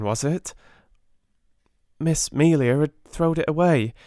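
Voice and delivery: lightly voiced shy scullery maid, stumbling; cockney maid Becky. A narrator's reading. Becky explaining the gift to Sarah, timid.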